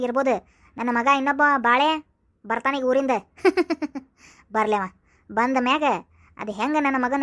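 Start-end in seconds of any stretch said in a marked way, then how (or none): 3.4-4.0 short laugh. Acted. Mother laughs mid-story.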